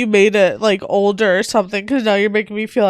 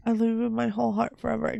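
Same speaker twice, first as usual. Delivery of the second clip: normal talking voice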